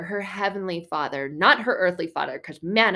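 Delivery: ordinary talk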